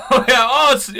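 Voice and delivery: high, loud laughing words. Real, not acted.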